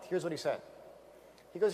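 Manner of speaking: ordinary delivery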